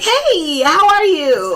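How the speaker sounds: put-on high, bubbly Valley Girl greeting. Shown as a demonstration. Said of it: "I get Valley Girl voice".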